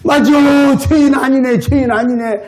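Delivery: high, loud cries of joy near tears; overjoyed exclamation. Retold or imitated. Old man realizing he is saved, joyful cries. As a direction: burst out that you're saved. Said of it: "울기 시작하는 거야".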